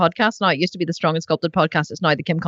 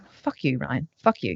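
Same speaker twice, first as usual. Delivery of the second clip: low, soft mock grumble; 6 semitones lower, 6 dB quieter, slower than usual. Wife grumbling low at husband for his blunt advice. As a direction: mutter it back at him in jest.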